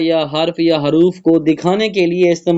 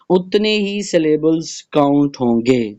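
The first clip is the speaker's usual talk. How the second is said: usual speaking voice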